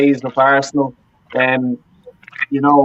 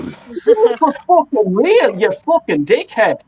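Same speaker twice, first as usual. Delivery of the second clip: higher-pitched, slow, furious rant; emotional outburst; 5 semitones higher, slower than usual. Real, not acted. Fan ranting at a Twitter troll, furious and slow. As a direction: spit out every word, slow and high. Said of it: "I'm getting emotional now".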